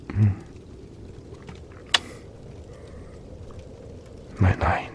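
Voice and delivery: soft, hushed goodnight murmur; sleepy bedtime whisper. Acted. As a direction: soothe them off to sleep. Lulling the hiker to sleep.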